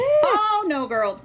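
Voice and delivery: loud, very high wail of disapproval; alarmed dismay. Real, not acted. Listener hearing that she got out of the car, loud high alarm. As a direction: cry out at a friend's bad decision. Reacting to friend leaving car.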